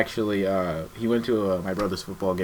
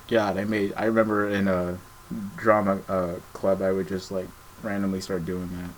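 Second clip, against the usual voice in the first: usual speaking voice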